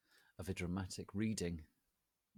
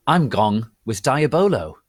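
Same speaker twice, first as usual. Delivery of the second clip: louder, a touch of hammed-up glee; mock happy; 7 semitones higher, 25 dB louder, slower than usual. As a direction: answer the friend with mock cheer. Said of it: "she answered happily".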